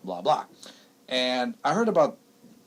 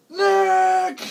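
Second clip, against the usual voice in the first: high, loud call of Nick; mock mother yell; 9 dB louder than usual. Son imitating mom calling him out, loud high yell. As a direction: shout the name like an angry mom. Mother yelling his name.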